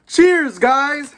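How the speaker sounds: high, bright toast to viewers; excited cheer. Real, not acted. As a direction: cheer them on, loudly.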